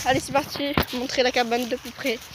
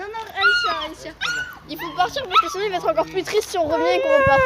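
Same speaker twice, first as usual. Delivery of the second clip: tearful; 10 semitones higher than usual. Real, not acted. Dog whining as they leave.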